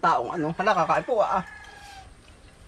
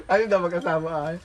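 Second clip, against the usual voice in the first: ordinary talk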